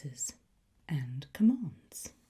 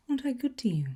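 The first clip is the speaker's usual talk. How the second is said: 1 teasing; 10 semitones higher, 5 dB louder, much faster than usual. Acted. Teasing the class.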